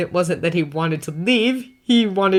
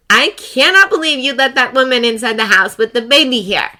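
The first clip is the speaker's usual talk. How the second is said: higher, louder clipped outrage; tight anger; 7 semitones higher, 7 dB louder than usual. Acted. Potts storms in angry about the visitor, tight-voiced. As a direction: hold the fury in, barely. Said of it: "voice tight".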